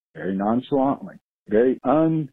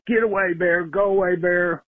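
raised, higher mock yell to shoo it; retold yell at a bear; 5 semitones higher than usual. Caller retells yelling at the bear, a mild higher yell. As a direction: shout the bear away, half-hearted. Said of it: "and yelled".

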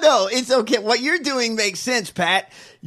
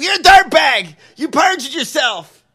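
mock accusing yell; 6 semitones higher, 8 dB louder than usual. Mocking senator's accusation.

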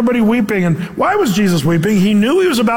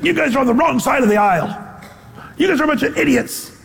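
mocking contempt; 7 semitones higher, faster than usual. Pastor mocks a preacher scorning unbelievers, high scornful jeer. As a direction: sneer it like a preacher insulting the crowd. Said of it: "Paul saw those people and he say".

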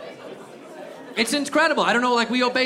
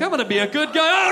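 fervent shout; 7 semitones higher than usual. Pretend. Vowing after near death.

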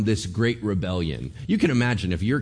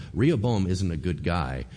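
normal talking voice

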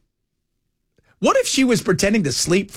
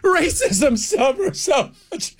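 much higher, slow, whining mock lament; mocking whiny victim; 13 semitones higher, slower than usual. Mocking the victim-vampire woman, high and whiny. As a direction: ham up a self-pitying wail of grievance. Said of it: "She's like".

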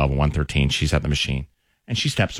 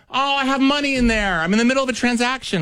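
high, prissy mock voice, much higher; imitating the other woman; 14 semitones higher than usual. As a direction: play the offended shopper, pitched way up. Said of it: "She's like".

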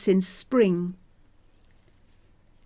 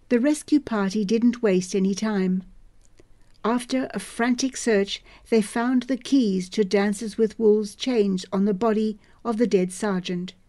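ordinary delivery

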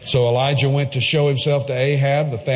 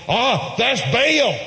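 mock believer; 9 semitones higher, slower than usual. Mocking the Baal worshippers.

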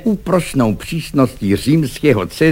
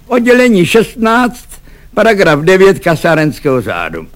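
high, loud regulation call; officious citing; 8 semitones higher, 4 dB louder than usual. A narrator's reading. Cadet adds the barracks regulation, loud and officious. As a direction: rap out the rule, high-pitched.

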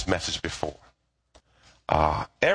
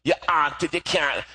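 imitated Jamaican mother scolding; Jamaican accent; 4 semitones higher than usual. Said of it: "in her Jamaican accent".

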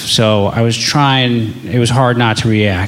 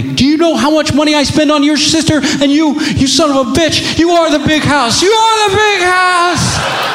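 furious dad; 14 semitones higher than usual. Retold or imitated. Father yelling at his son about the big house, furious high shout. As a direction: blow up at your son in frustration. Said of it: "grab him and shake him and say".

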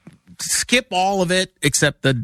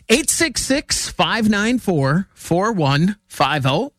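slow number read; 4 semitones higher, slower than usual. Acted. Reading out the show's number again.

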